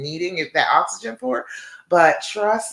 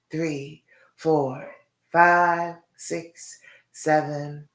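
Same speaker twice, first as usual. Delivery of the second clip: guided breathing count; much slower than usual. Acted. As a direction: count them through the breathing gently.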